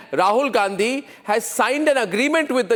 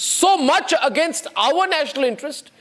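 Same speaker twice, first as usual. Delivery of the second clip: shouting; 5 semitones higher than usual. Real, not acted. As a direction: shout him down with outrage. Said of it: "you cannot shout me out".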